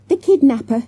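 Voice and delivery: high, loud dramatic gasp; gasped alarm. A narrator's reading. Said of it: "Jessica gasped".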